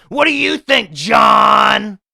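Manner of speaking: high, loud put-on yell; mock shout. Podcaster throwing the question back at listener John, hammed-up high shout. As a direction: holler the question, blasting the name.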